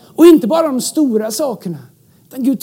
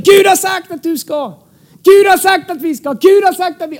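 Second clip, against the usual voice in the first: charismatic, with feeling; 5 semitones higher, 4 dB louder than usual. Shown as a demonstration. Pastor showing how manipulation sounds, charismatic proclamation. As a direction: lay on hammed-up charisma, pitched up. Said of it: "lägger vi då på lite karismatik".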